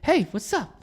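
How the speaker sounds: Mark Wahlberg. Retold or imitated. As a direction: imitate a caller's breezy hello. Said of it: "was actually Mark Wahlberg".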